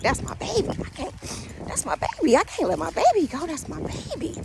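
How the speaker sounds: high, tearful pleading, reliving the loss; crying. Retold or imitated. Owner retelling pleading at the vet, tearfully high. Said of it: "So I'm crying".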